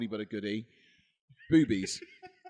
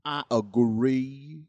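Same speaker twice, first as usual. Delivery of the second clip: slow, louder, rising agree; drawn-out agree; 2 semitones higher, 5 dB louder, much slower than usual. Shown as a demonstration. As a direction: stretch agree out with a rise.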